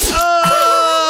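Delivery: loud, high mock scream; hammed-up shriek of pain. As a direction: scream as if the hands burst. [name] screams as blades move.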